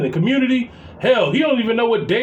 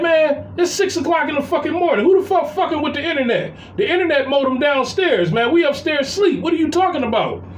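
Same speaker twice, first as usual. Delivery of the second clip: high-pitched exasperated protest, not yelled; retold indignant rant; 7 semitones higher than usual. Stepdad retells arguing with stepson at six a.m., indignant and high. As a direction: re-enact arguing back at an absurd accusation.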